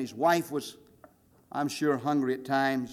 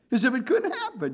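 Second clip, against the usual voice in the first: amused laugh; 11 semitones higher than usual. Real, not acted. Laughing at the irony.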